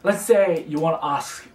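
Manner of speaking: usual speaking voice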